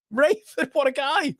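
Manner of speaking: high, gushing mock-adoring praise. Thanking a kind viewer, in a high mock-gushing voice. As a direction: swoon over him playfully. Gushing over a supportive comment.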